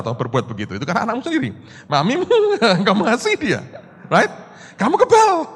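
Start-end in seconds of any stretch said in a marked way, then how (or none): 1.9-4.3 high, amused, laughing through the words. Real, not acted. Pastor joking about going out with mother, laughing. Laughs at his own example. 4.7-5.5 very high, loud punchline; emphatic exclamation. Real, not acted. Pastor declaring the friend now immune, exclaiming.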